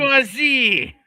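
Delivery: put-on high, loud French-accented cry; mock French Marxist. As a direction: exclaim it as a hammed-up French Marxist. Mimicking the film's French Marxist.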